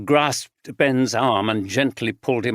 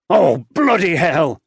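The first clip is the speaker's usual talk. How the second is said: higher, louder alarmed shout; 5 semitones higher, 6 dB louder than usual. A narrator's reading. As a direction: curse loudly on realizing the mistake. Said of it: "he shouted".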